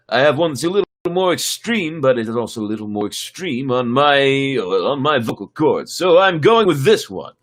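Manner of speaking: normal talking voice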